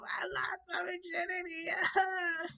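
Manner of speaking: slow, high-pitched mock wail; bawling. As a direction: blubber it out, hammed-up. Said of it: "I'm still bawling".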